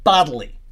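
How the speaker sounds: BODILY punched loud; "bodily" set apart with forceful stress; emphatic. Real, not acted. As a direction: hit the word "bodily" firmly and loudly. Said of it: "Those are all emphatic in the Greek".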